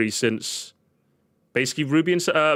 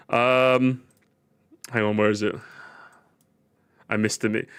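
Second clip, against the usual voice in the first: normal talking voice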